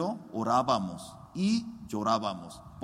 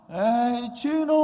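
shouted high and loud across the street; mocking street shout; 8 semitones higher, 4 dB louder, slower than usual. Strangers shouting at the pastor in the street, jeering yell. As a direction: call out to him with a jeering yell. Street people calling him out.

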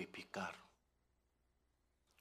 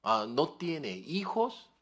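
ordinary talk

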